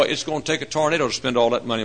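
usual speaking voice

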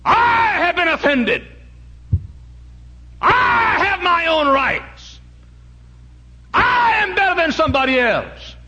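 high, loud, slow indignant shout; mocking a proud, offended believer. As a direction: puff up and demand your rights. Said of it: "a proud look".

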